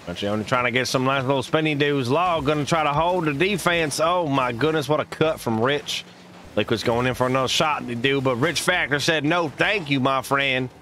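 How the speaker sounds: lively esports play-by-play, rising on big plays; play-by-play casting. Acted. Streamer casting a match to viewers, lively play-by-play. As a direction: call the match like a hyped caster.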